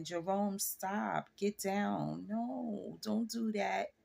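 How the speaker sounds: a half-hearted no with a smile; giggly soft no. Retold or imitated. Wife to Jerome, soft and giggly, not meaning it. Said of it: "the way I just said it".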